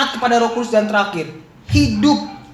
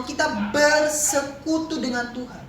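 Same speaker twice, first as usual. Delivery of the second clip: ordinary delivery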